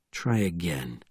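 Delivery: low, soft, unruffled reply; quiet retort. A narrator's reading. Bourne replying to the panicking sergeant, cool quiet voice. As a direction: answer the panic coolly and quietly.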